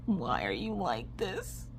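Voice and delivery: soft, slow mock lament; despairing plea. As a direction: ask it weakly, at wits' end. Despair at indecisive son.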